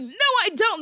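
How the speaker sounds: put-on high-pitched childish denial; mock babyish yelling. Host imitates woman denying she wants him, mock babyish yelling. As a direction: shoot back like a squabbling kid. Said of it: "yelling at each other like babies".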